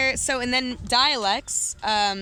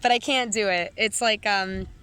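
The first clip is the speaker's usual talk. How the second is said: normal talking voice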